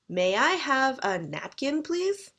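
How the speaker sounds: rising Canadian tune on please, ending high; Canadian intonation. Shown as a demonstration. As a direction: ask it the Canadian way with please lifted up. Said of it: "I'm Canadian, so I say".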